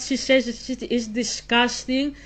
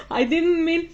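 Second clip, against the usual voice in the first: laughing; 6 semitones higher than usual. Real, not acted. Still laughing after joke.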